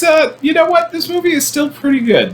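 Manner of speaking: jumps way up high, delighted rediscovery; squeaky high burst of surprised delight; pleasantly surprised. Real, not acted. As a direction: gush as if just realizing it's good. Sudden enthusiastic reappraisal of movie.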